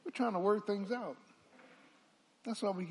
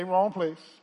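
louder, emphatic repeat of "Wrong place."; congregation call back; 7 dB louder than usual. Shown as a demonstration.

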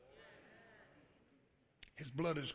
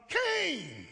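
high calling voice of God; God calling Cain; 9 semitones higher than usual. Acted. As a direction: call out his name from above.

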